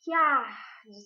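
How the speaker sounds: "Que arde" fused into a slow, stretched "kiar-de"; "Que arde" as one drawn-out glide, "ar" held long; joined syllables. Shown as a demonstration. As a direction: slide "Que" into "ar" with no break. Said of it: "Esse que e o ar são ditos juntos".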